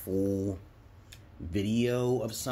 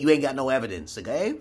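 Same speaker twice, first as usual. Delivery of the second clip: ordinary delivery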